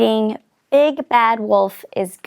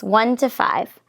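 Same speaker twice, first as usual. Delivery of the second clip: ordinary delivery, nothing marked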